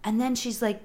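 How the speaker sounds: ordinary talk, nothing marked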